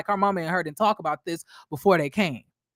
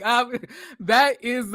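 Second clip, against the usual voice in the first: ordinary talk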